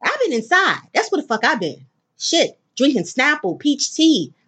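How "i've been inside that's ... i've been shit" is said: high, sassy indignant retort; indignant comeback. Real, not acted. She tells listeners where she's been, indignant and pitched high. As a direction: fire it back with attitude, pitched up. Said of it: "snapping back about staying inside".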